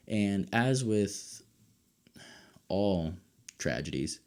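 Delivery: ordinary talk, nothing marked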